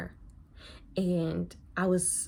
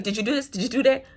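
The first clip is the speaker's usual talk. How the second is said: imitating a yelling preceptor; 3 semitones higher, 5 dB louder, faster than usual. Preceptor grilling the new nurse, loud mock yell. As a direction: fire questions at the new nurse like a strict boss. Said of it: "She be yelling at me".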